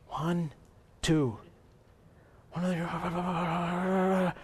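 soft, hesitant head count; acted quiet puzzled tally; muttered counting. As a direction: count under your breath. Servant counting their own side.

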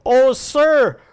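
high, loud panicked plea; alarmed cry. A narrator's reading. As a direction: cry out to your master for help. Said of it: "the young man cried to Elisha".

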